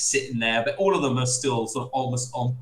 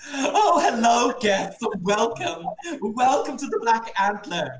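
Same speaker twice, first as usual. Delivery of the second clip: much higher, very slow, laughing innkeeper welcome; sudden put-on host voice; 11 semitones higher, 3 dB louder, slower than usual. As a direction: greet the guests in a silly high drawn-out host voice. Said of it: "just this voice comes out".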